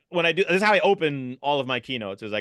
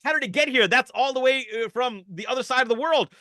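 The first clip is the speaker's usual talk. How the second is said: shocked man; 9 semitones higher than usual. Acted. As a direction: gasp it out in alarm. Man shocked at dangerous butterfly.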